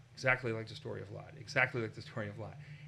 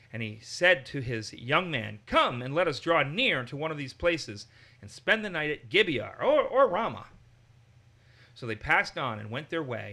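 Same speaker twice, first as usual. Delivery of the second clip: louder, measured reading of a Bible passage; scripture reading; 4 dB louder than usual. Acted. Leader reading scripture to the group, steady and louder. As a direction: read the passage out to the study group.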